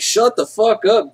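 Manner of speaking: irritated teacher telling off; teacher scolding. Retold or imitated. Teacher scolding the heckling student, irritated snap. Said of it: "He's like".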